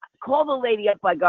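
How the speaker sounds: usual speaking voice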